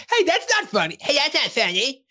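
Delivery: very high whiny protest; high cartoon kid whining; Cartman voice. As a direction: whine it like an offended kid. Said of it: "everybody just starts laughing".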